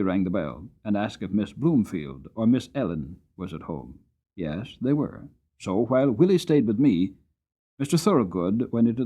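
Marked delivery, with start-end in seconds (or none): none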